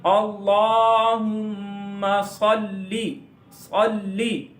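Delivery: अल्लाहुम्मा drawn out with hummed mm, heavy S in सल्ली; slow recitation, pausing on the doubled l of सल्ली; measured recitation. Shown as a demonstration. Teacher models the pause on laam, slow recitation. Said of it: "यहाँ पर थोड़ा सा रुकेंगे".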